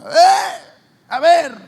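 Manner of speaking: furious mother, high and loud; angry mother. Retold or imitated. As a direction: storm in shouting.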